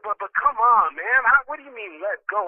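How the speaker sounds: loud, high-pitched objection; acted-out exasperated pleading; indignant protest. Acted protest at friend's advice.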